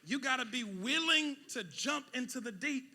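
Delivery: loud, high preaching; shouting sermon; full-force preaching. Acted. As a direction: preach it hard to the room.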